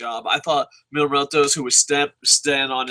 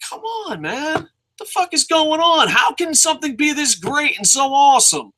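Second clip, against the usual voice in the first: overjoyed; 9 semitones higher than usual. Real, not acted. Overcome with joy.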